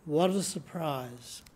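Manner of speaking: normal talking voice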